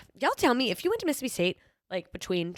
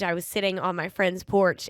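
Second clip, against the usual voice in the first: normal talking voice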